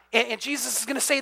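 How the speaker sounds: ordinary talk, nothing marked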